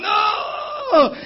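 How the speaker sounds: high loud mock cry of collapse; loud, high mock yelp; retold cry out. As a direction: cry out as the legs give way. Collapsing dizzy on the floor.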